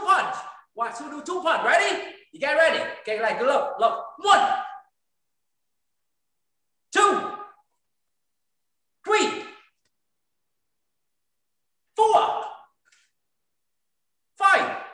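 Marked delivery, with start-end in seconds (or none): 4.2-14.8 high, called-out count; drill count. Real, not acted. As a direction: call each rep out. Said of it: "instructor counting out drill reps".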